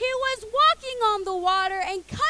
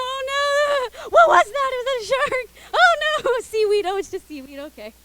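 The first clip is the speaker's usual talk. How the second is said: higher, louder hammed-up panic with a shout; mock freakout; 6 semitones higher, 7 dB louder than usual. Imagining walking on water, hammed-up panic. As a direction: yelp in fake fright then calm down. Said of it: "I'd be freaking out".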